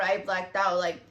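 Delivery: ordinary talk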